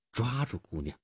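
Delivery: usual speaking voice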